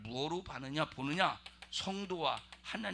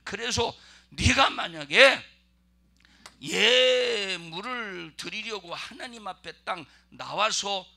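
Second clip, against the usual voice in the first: fervent preaching; 5 semitones higher, 12 dB louder, slower than usual. Real, not acted. Preacher building to climax.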